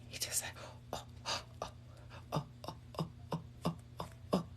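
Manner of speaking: tiny whispered "oh, oh, oh" pants; happy dragon noises. Retold or imitated. Reader showing how her dragon reacts, whispered imitation.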